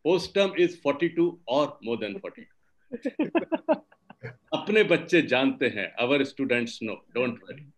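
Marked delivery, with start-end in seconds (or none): 2.9-3.8 laugh. Real, not acted. Laughs after reciting dates.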